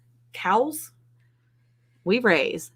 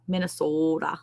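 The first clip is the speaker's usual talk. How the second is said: Minnesota with a very long, soft O; Minnesota accent; 6 dB quieter than usual. Shown as a demonstration. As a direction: tease with a stretched Minnesotan O in Minnesota. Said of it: "That's my accent version".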